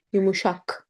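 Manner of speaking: "Yumuşak" with an open a and back k; correct pronunciation. Shown as a demonstration. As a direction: model "Yumuşak" as yu-mu-SHAHK. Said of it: "not yumuşak".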